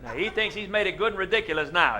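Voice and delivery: ordinary talk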